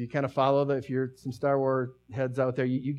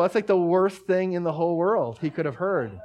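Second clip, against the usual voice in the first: ordinary talk, nothing marked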